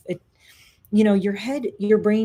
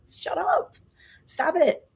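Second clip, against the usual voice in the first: much higher, laughing protest; playful telling-off; 11 semitones higher, slower than usual. Acted. Speaker to the negative voice in another body, high playful telling-off. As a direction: squeal it as a mock protest. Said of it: "put that voice in another body and say".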